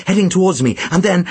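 ordinary delivery

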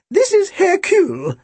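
put-on thin, very high old-woman voice; elderly shopkeeper, thin and high; Missus Bodega. A narrator's reading. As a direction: introduce yourself in a birdlike high pitch. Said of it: "Her voice was thin and high-pitched".

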